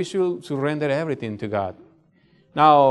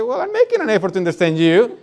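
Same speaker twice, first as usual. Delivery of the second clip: passive aggressive; 7 semitones higher, 4 dB louder than usual. Retold or imitated. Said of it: "passive aggressive".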